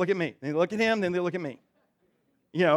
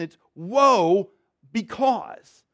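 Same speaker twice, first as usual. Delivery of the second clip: "woe" drawn out very slowly and raised; stress on woe because; 3 semitones higher, 5 dB louder, much slower than usual. Shown as a demonstration. Pastor drives home his point to the congregation, slow and emphatic. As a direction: stretch "woe" long and lift it, then land "because". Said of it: "pastor repeats key phrase".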